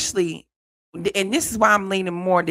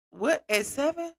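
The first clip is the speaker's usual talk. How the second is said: high, softer disbelief; pitched-up, disbelieving question; incredulous; 7 semitones higher, 5 dB quieter than usual. Real, not acted. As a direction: question it in baffled shock. Shocked at the age mentioned.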